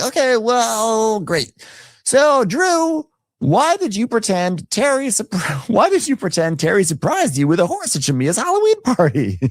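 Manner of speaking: very high, slow teasing host voice; a campy sing-song host grilling a guest; mocking Andy. Host Andy quizzing Drew, campy high impression. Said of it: "Andy's like".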